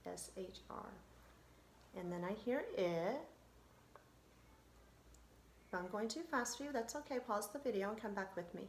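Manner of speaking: ordinary delivery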